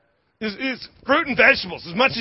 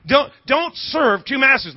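preaching shout; 6 semitones higher, 3 dB louder, slower than usual. Real, not acted. Preacher's emphatic command.

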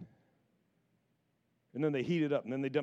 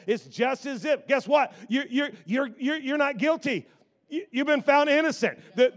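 louder and higher, rising preach; growing revival preaching; preaching build; 9 semitones higher, 9 dB louder than usual. Acted. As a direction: build the congregation up with each line. Preacher builds to full force.

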